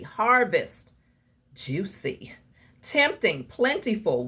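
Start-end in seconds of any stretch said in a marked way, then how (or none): 1.5-2.3 a soft whisper on the word. Real, not acted. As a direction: breathe the word out, teasing. Listing tempting harvest words.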